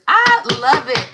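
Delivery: light up on the words I love it; delighted. Real, not acted. Card reader delighted by card.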